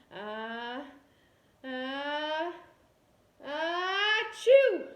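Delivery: building sneeze, ah-ah-ah rising to a loud chew. Acted. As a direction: wind up and burst into a big sneeze. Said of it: "Andrew went".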